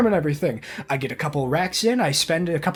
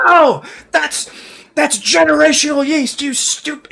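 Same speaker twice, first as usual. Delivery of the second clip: much higher and louder, a furious cry of disbelief; outraged shout; 9 semitones higher, 7 dB louder, slower than usual. Acted. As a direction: yell like your treasure was just thrown away. Outraged over thrown-out sourdough starter.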